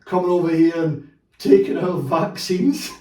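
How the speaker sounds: sarcastic slow mimicry of a xenophobe; mock bigot phrase. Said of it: "I've heard".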